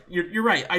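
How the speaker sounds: loud, laughing concession. Real, not acted.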